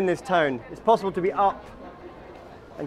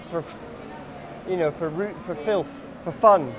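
normal talking voice

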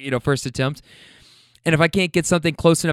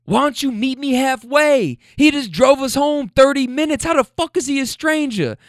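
imitating him yelling; 11 semitones higher than usual. Boyfriend arguing the driver is no stranger, mock yell. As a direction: push back hard, retold. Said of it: "He goes".

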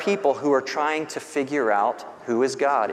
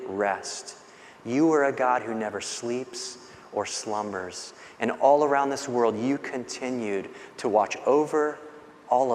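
softer, hushed personal prayer; quiet prayer; 4 dB quieter than usual. Shown as a demonstration. Pastor voices his morning prayer to God, hushed. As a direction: speak to God gently, just waking.

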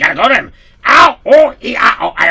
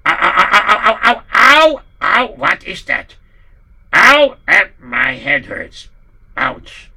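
pained groaning; 8 dB louder than usual. Acted. Poritz suddenly in pain.

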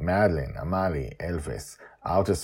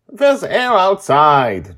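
put-on high, loud, slow child voice; explaining girl; 13 semitones higher, 10 dB louder, much slower than usual. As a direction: spell it out slowly through glass. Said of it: "Joy tells her".

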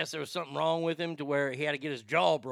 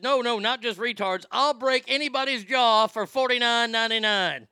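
loud, pitched-up brute boasting; barbarian; 6 semitones higher, 5 dB louder than usual. Retold or imitated. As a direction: bellow it like a thug for hire. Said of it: "You know how Arnie beats up children".

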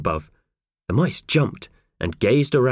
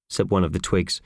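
plain reading voice

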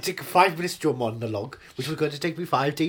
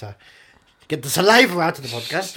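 ordinary talk, nothing marked

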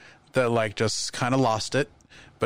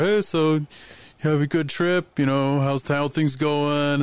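slightly raised, chatty pretend greeting; forced small talk; 4 semitones higher, slower than usual. Keeping a celebrity occupied before the show, forced small talk. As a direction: lightly put on polite chit-chat.

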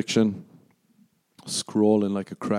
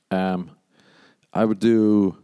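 ordinary talk, nothing marked

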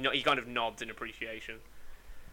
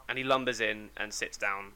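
ordinary delivery

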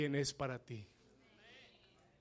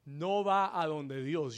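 ordinary talk

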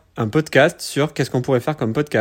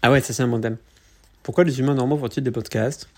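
normal talking voice

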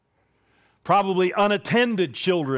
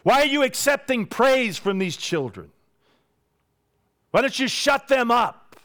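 indignant challenge; 7 semitones higher than usual. Retold or imitated. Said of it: "They want to know".

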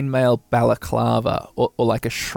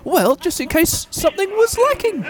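much higher, nervous little dwarf excuse; squeaky dwarf. Acted. Voicing the frightened dwarf.

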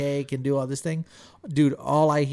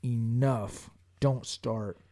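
stern warning, lower and softer; 4 semitones lower, 5 dB quieter, much slower than usual. Retold or imitated. Retelling the stern look given to tearful Elisa, low and stern. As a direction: drop the voice for a firm, controlled shut-down. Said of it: "I'm giving her that stern".

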